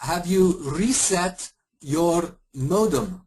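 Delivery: a mock Indian agent spelling it out slowly; hammed-up Indian accent, deliberate; bad Indian accent, slowed. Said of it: "really bad Indian and Eastern European accent".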